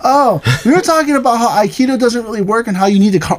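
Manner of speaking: high, breezy know-it-all voice; imitating own ignorant younger self; naive young student. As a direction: blurt it out like a clueless kid. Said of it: "I said".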